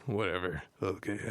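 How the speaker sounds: ordinary delivery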